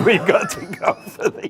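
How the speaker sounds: high-pitched, laughing through the words. Real, not acted. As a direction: joke breaking up into laughter. Joking, both break into laughter.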